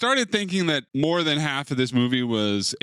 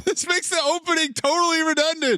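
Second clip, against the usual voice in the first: high-pitched mock exasperation; exasperated protest. Viewer interrupting redundant voiceover, high exasperated protest. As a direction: interrupt in high, indignant disbelief.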